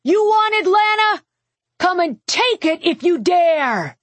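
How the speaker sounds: high, carrying cry of defiance; battlefield challenge. A narrator's reading. Defender answering the enemy commander across the field, high defiant call. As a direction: hurl a dare down the battlefield. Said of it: "sending my voice down the battlefield".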